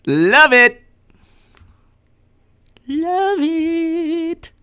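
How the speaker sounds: high, loud gleeful cheer; bright squealed celebration; excited cheering. Real, not acted. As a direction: celebrate like a game show host. Cheering donations.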